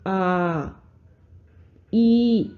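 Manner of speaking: आ held very long and slow, then ई; drawn-out pluta vowels. Shown as a demonstration. Teacher shows students the extra-long pluta vowel, drawn out. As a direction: stretch आ far beyond a long vowel. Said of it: "दीर्घ स्वर से भी लंबा प्लुत स्वर".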